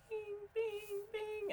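sing-song high beeps like a car alarm; high beeping imitation. Imitating the car alarm to a friend, high electronic beeps. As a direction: chime bing bing in a high thin tone. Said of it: "and it's like".

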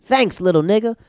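high, loud cackling thanks; hammed-up signature cackle; Eddie Murphy impression. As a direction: do his famous laugh. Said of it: "And Eddie goes".

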